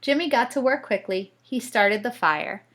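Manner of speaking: ordinary delivery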